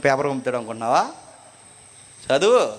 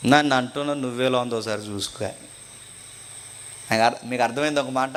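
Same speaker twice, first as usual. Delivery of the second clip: ordinary talk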